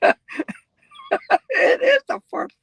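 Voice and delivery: squeaky high wheeze of laughter through the words; cracking up so hard the words barely get out; helpless laughter. Real, not acted. Laughing at a viewer's accent, squeaking the words out. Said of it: "I don't mean to laugh at you".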